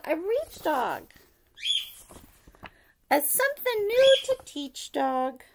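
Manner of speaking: ordinary delivery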